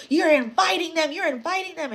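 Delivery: high, loud urgent warning; alarmed grandmother scolding. Retold or imitated. Grandmother warns child about inviting spirits, mock alarmed scolding. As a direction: imitate a grandmother frantically scolding.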